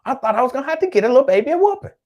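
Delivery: high, loud, rapid exclamation. Real, not acted. Host telling viewers about the misbehaving baby, loud and high. Repeats whispered line out loud.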